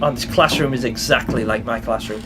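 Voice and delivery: ordinary talk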